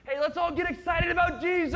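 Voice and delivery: loud, high hyped-up cheer; churchy excitement. Retold or imitated. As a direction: shout it like a revival crowd. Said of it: "we can do this in church".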